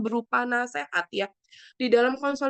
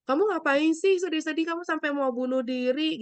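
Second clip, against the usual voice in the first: mild mock reproach, pitched high; higher-pitched judgmental questioning; judgmental scolding; 7 semitones higher than usual. Shown as a demonstration. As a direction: voice a dismissive, judging reaction as a bad example. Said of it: "kita tidak melakukan judgmental".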